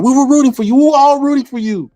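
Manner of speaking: very high falsetto, sing-song sympathetic; Tyra Banks imitation. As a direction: channel a teary TV host letting you down gently. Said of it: "what Tyra Banks said".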